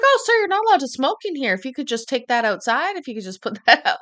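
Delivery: high, fast, syrupy customer-service voice; polite manager voice. Retold or imitated. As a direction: chirp it like an overly nice clerk. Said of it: "He was just like".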